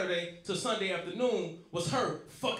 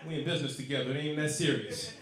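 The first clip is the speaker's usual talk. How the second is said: cool denial; 5 semitones lower than usual. Retold or imitated. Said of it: "I'm like".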